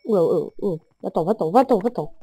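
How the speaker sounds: usual speaking voice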